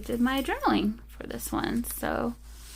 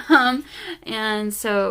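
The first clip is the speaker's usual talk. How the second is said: ordinary talk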